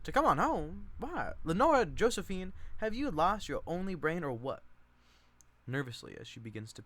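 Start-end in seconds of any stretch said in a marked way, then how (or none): 0.0-4.6 higher, louder exasperated matron; scolding cousin Chick. Acted. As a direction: scold her like a silly child.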